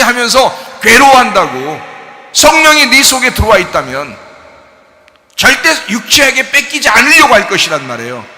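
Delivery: loud, high, driving sermon voice; full-force preaching. Acted. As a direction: preach it hard and loud to the congregation.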